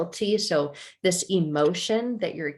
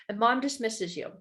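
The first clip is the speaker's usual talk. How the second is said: ordinary delivery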